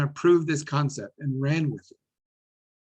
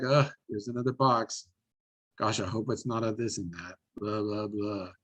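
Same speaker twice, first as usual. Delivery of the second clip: bored, unenthused; 5 semitones lower, 4 dB quieter than usual. Retold or imitated. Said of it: "It can't be like".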